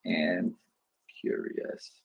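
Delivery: whispered "sound excited and curious" while typing; whispered note. Shown as a demonstration. Coach murmuring as he types notes, whispered. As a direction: mouth the note quietly under your breath. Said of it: "I'm gonna put here".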